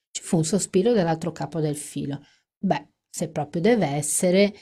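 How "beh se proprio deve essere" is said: ordinary delivery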